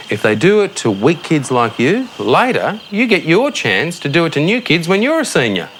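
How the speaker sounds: ordinary talk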